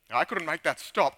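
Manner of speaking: usual speaking voice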